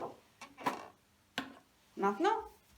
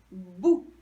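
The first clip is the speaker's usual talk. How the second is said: "Bou" alone, lower and louder; isolated syllable; 4 semitones lower, 8 dB louder than usual. Shown as a demonstration. Teacher confirming the shared beginning, isolated syllable. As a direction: punch a low "Bou". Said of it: "la même chose au début".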